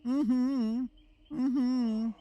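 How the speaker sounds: high, hummed snooty mm-hmms; mock posh hum, high and soft; posh Lucy impression. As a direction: play a prim posh lady humming approval. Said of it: "all I could think of is Lucy going".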